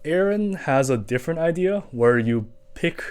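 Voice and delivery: ordinary talk, nothing marked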